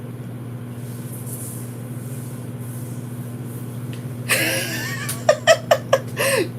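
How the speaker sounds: a long, high, breathless laugh; long high laughter. Real, not acted. Laughing at her own story of burying the dog, long high laughter. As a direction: break into long helpless laughter.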